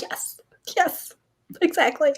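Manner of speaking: high, breathy half-whispered yes yes; eager agreement. Retold or imitated. Agreeing with Curran's hope while retelling, high eager whisper. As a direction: gush it out under the breath.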